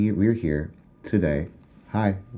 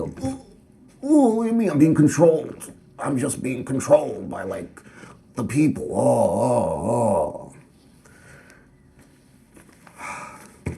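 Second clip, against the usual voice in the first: Muppet voice; 5 semitones higher than usual. Pretend. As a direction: squeal like a helpless puppet on strings. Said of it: "I'm like a Muppet".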